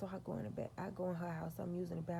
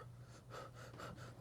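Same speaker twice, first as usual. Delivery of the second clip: sharp gasp; 14 dB quieter than usual. Acted. Demon reaction reenacted.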